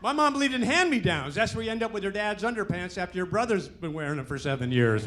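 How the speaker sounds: ordinary delivery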